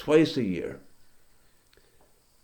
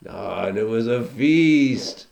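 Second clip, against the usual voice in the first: savoring feast; 4 semitones lower, 4 dB louder, slower than usual. Acted. Savouring the memory of the feast.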